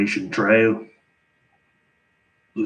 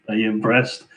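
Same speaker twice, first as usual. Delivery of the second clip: normal talking voice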